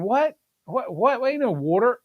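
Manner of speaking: just woken and can't take it in; imitated half-asleep bafflement with high rising questions; groggy disbelief. Groggy sleeper doubting the warning.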